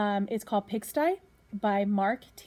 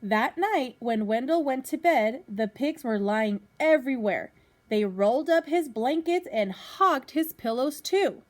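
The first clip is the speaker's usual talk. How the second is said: lively storybook reading to a class; reading to children; 3 semitones higher, 8 dB louder, slower than usual. Acted. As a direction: read it out like a teacher at story time. Teacher reads picture book to class.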